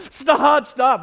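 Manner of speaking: loud, high-pitched cry of stop; frantic shout. Retold or imitated. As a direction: yell stop at a backing taxi. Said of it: "And I'm honking, going".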